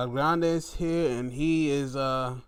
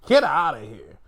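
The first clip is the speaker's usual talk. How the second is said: mocking Punk; 7 dB louder than usual. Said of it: "Pushes Gunther down like".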